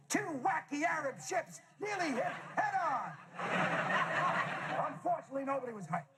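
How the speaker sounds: high, shouted brash American news read; American newscaster. Retold or imitated. Brash American newscaster reading the same story. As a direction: blare it like a hyped American anchor. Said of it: "the same broadcast in America".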